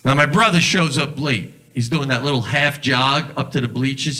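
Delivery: ordinary talk, nothing marked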